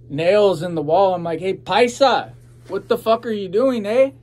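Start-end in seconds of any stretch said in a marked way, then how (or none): 1.4-4.1 hammed-up yell he never really did; mock yell. Waking up to hammering, a pretend loud yell at the worker. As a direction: pretend shout at the worker, higher and louder. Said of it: "No, not really".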